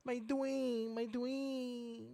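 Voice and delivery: high, slow, dreamy "my Dwayne"; swooning lovestruck voice. Retold or imitated. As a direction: sigh it like a smitten fan. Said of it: "she wanted to pursue".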